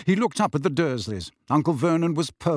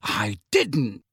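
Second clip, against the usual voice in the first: sharply. Acted. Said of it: "Harry said sharply".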